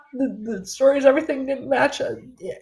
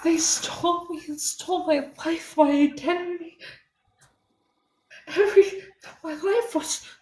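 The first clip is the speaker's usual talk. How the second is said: high, wavering through tears; crying it out; tearful distress; 6 semitones higher, 4 dB quieter than usual. Real, not acted.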